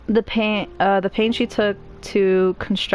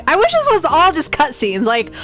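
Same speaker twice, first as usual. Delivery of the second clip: higher and louder, playfully fed up; exasperated whine; 7 semitones higher, 4 dB louder than usual. Real, not acted. Streamer protesting all the reading, mock exasperated whine. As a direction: complain about the wall of text.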